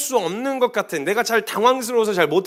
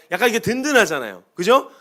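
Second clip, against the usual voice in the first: usual speaking voice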